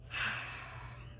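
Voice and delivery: long, soft exhale; heavy sigh. Retold or imitated. Friend hearing she told his best friend, deflated sigh. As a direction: imitate a stunned friend's sigh. Friend's reaction to bad news.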